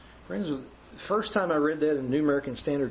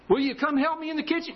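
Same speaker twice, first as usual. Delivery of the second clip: higher, lightly voiced wife calling out; 12 semitones higher than usual. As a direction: call sweetly from the kitchen. Wife asks again.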